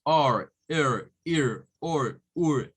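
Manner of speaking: or, er, ir, or, ur each with a curled r; English vowel sounds. Shown as a demonstration. Teacher listing English r-coloured vowels. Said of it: "no hacen sonidos".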